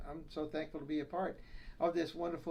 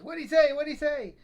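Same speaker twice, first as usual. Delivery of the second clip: put-on high, louder old wife straining to hear; hard-of-hearing wife; 9 semitones higher, 9 dB louder than usual. Deaf wife asking her husband, high and loud. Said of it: "she says".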